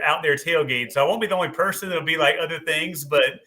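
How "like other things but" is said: ordinary talk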